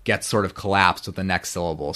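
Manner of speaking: ordinary talk, nothing marked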